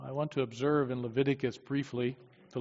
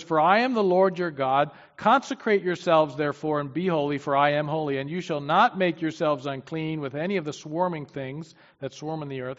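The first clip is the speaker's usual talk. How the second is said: louder, solemn Bible reading; preacher reading God's words to the congregation, louder and solemn; scripture reading; 6 dB louder than usual. Acted. Preacher reads Leviticus to his congregation, solemn and louder.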